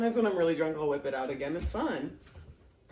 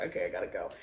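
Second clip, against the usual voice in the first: whispered; 12 semitones lower, 8 dB quieter, much faster than usual. Retold or imitated. Retold quitting after crying lab.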